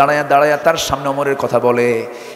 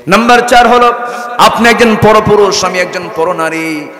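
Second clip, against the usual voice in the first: loud, high point four; preaching climax, loud and high; emphatic counting; 7 semitones higher, 6 dB louder than usual. Acted. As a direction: hammer the fourth fault home. Woman counts fault four loudly.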